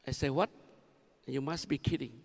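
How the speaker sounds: ordinary talk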